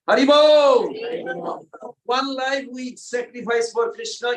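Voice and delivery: joyful shout to the room; rallying cry. Real, not acted. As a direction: shout it out to the hall. Preacher's rallying call.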